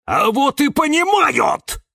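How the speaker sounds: very high, loud, drawn-out protest; defiant yelling. A narrator's reading. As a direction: shout it back defiantly. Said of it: "перешёл на крик Кирилл". (упрямый крик)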